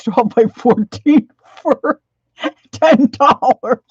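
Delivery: high laughing delight. Real, not acted. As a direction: giggle through the price. Giddy dream of a bargain.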